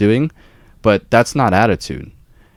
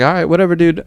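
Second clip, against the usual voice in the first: dismissive; 9 semitones higher, faster than usual. Retold or imitated. Dismissive reply to the inspector.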